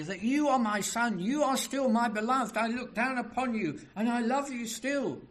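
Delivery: high, loud, fervent assurance; preacher voicing God with passionate conviction; encouraging Father. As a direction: call down encouragement to a beloved son. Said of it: "He speaks to encourage his Son".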